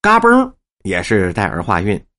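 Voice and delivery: normal talking voice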